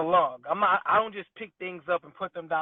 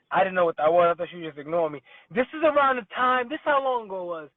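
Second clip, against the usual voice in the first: ordinary talk, nothing marked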